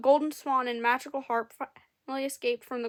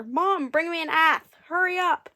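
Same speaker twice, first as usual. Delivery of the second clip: higher, louder urgent shout; boy shouting for his mother to hurry; 5 semitones higher, 6 dB louder than usual. A narrator's reading. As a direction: yell for the axe, panicked. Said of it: "he shouted at his mother".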